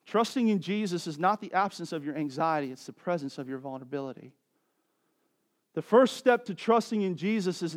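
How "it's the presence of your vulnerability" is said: softer and quicker, hushed; soft repeat. Shown as a demonstration. Pastor repeating his point to the congregation, softly and quickly. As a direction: let it sink in, softly and quickly. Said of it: "repeated key line, softer".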